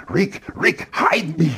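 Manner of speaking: intense, high-pitched actor impression; Peter Lorre. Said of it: "he can get in text".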